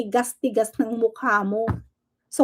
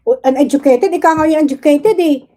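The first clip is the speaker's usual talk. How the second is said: angry retort; 3 semitones higher, 5 dB louder than usual. Real, not acted. Galit na sagot sa insulto.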